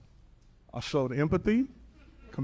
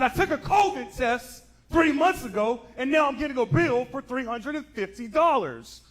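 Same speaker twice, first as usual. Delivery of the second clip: complaining caller; 9 semitones higher, 4 dB louder than usual. Retold or imitated. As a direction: whine about the bill.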